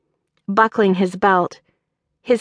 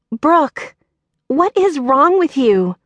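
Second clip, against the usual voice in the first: high, raised and rattled; startled cry; 7 semitones higher than usual. A narrator's reading. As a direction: demand an answer, heart still pounding. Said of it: "Evie cried".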